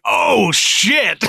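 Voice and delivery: a sudden high, loud yelp of shock; shocked oh shit. Retold or imitated. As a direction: blurt it out, caught off guard. Reacting to manager's gaffe.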